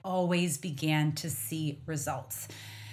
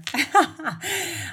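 laughing; 10 semitones higher, 5 dB louder than usual. Real, not acted. Laughs at own accent.

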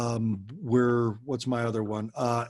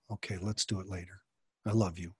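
much softer, almost hushed and tender; tender parent; 5 semitones lower, 8 dB quieter, faster than usual. Shown as a demonstration. Parent reassuring a refusing daughter, hushed and tender. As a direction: let her go with love, quietly. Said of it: "low tone of voice and being really soft".

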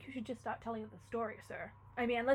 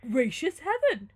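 loud, raised, slow exclamation; startled cry; 5 semitones higher, 10 dB louder, slower than usual. Acted. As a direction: cry out as a holy man taken aback. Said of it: "cried the holy man, starting".

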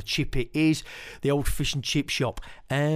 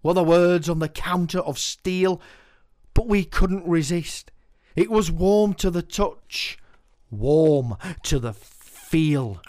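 poem recital; 6 semitones higher, 6 dB louder than usual. Acted. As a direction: paint the memory line by line. Performs Chippy poem.

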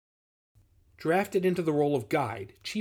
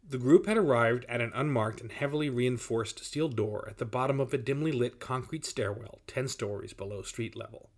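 usual speaking voice

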